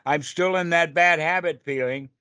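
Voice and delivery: a touch of self-pitying grumble; mock whining complaint. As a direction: play the frustrated meditator who gives up. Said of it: "And so we say".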